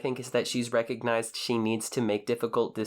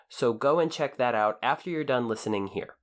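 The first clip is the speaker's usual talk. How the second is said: ordinary talk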